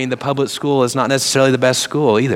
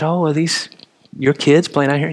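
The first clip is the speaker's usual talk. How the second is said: lightly voiced sweet old lady, a bit higher; a hint of a kindly grandmother asking; sweet grandmotherly voice; 4 semitones higher than usual. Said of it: "grandmotherly type of person".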